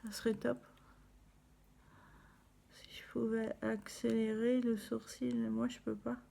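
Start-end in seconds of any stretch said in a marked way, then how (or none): 2.7-3.1 murmuré pour soi; chuchoté. Real, not acted. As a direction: chuchoter en travaillant. Concentrée, se parle à elle-même. (chuchotement pour soi)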